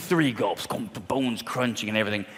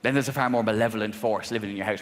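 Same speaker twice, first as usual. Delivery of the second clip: normal talking voice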